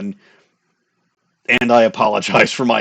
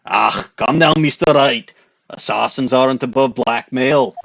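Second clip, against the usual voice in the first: put-on Scottish accent; 4 dB louder than usual. Retake of the character's line.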